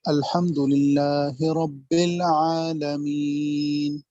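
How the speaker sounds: slow, melodic recitation of الحمدللہ رب العالمین; slow, chanted Quran recitation; Quran recitation demo. Shown as a demonstration. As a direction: intone the verses slowly, as in tajweed. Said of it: "مثال کے طور پر".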